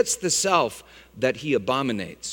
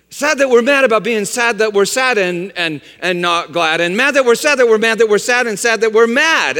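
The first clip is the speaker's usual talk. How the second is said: higher, louder rattle of sad and mad; tumbling wordplay; 5 semitones higher, 6 dB louder than usual. Real, not acted. Preacher mocking guilt over sadness, rattling rhymes faster and louder. Tongue-twister spiralling, building fast and loud.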